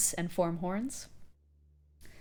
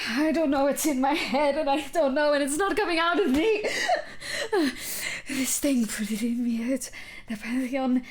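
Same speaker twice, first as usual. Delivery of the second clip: high, loud panicked outburst; distraught youngster losing control, high-pitched; much younger voice; 8 semitones higher, 7 dB louder than usual. Acted. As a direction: blurt it out, frantic and overwhelmed. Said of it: "it's a much younger voice".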